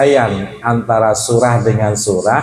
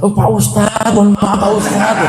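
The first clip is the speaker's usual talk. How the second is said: higher, laughing apology as the rider; sheepish apology; 9 semitones higher than usual. Retold or imitated. Preacher voices embarrassed rider apologising to him, high and laughing. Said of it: "Begitu saya buka".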